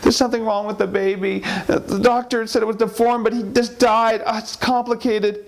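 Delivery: high fretting female voice; wife's anxious voice. Acted. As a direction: pitch up and worry. Wife explains deformed baby.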